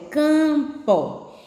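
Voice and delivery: CAMPO in two parts, CAM held high, then PO; syllable demonstration. Shown as a demonstration. Teacher joins the syllables for pupils, stretched demonstration. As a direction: split Campo into a long cam and a separate po. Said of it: "vai ficar o pó".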